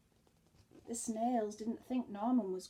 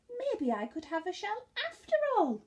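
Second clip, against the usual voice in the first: hopeful idea; 9 semitones higher, 10 dB louder than usual. Acted. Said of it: "he thought".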